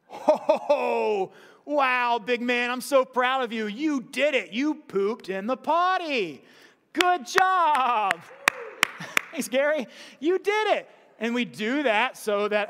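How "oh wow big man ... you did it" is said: high gushing parent voice; potty training praise. Retold or imitated. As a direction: gush over the toddler, louder.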